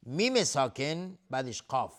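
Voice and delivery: ordinary talk, nothing marked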